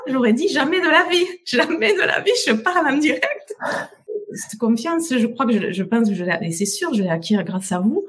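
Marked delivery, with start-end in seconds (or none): 0.1-3.5 laughing disbelief. Real, not acted. Laughing at her old self.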